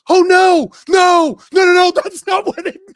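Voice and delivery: high, loud, frantic no no no; panicked denial. Retold or imitated. Shop owner frantically denying the word to the customer, high panicked shouting. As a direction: wave it off in a panic, voice shooting up. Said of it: "then he flashes like".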